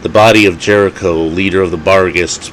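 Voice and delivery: usual speaking voice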